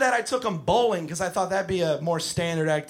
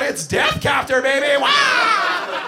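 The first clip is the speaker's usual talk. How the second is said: put-on high, loud gleeful kid yell; kid yelling gleefully; 9 semitones higher, 6 dB louder, slower than usual. Spinning kid yelling his helicopter name, gleeful. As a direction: whoop like a thrilled kid. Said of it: "he yells out".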